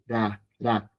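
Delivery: "da" as a light tap between R and L; tapped R. Shown as a demonstration. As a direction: flick the tongue once for each "da". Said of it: "Como si fuera una L".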